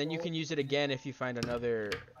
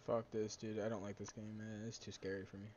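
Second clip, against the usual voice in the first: much softer, nervous mumble; quiet and scared; 6 semitones lower, 18 dB quieter than usual. Real, not acted. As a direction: drop your voice, spooked. Scared while playing horror game.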